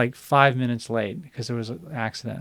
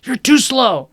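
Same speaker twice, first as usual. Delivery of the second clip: much higher, louder mock sensei; imitating sensei's shout; 10 semitones higher, 6 dB louder, slower than usual. Sensei cutting the punishment short, mock shout. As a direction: scold from across the dojo. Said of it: "he's like".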